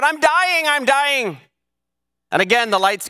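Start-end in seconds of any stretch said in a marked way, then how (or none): 0.0-1.4 high-pitched acted cry of a victim. Stabbed woman crying out to her neighbors, acted dying cry. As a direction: cry it out twice, pitched well up. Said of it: "She again cried out".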